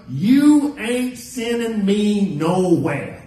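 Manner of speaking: slow, emphatic retold refusal; blunt slangy refusal. Preacher retells refusing the doctor's daughter, slow emphatic defiance. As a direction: draw out each word in mock defiance. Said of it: "my words were".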